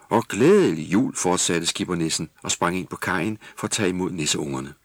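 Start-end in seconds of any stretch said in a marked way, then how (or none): 0.1-1.1 hearty greeting. Acted. Skipper greeting children.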